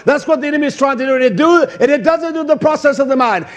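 ordinary delivery

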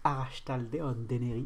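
put-on half-hearted Basque accent; lightly put-on Basque accent; mauvais accent basque. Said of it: "avec mon accent basque dégueulasse". (petit accent basque raté)

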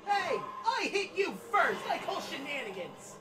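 raging gamer. Real, not acted. Protests mid-fight in game.

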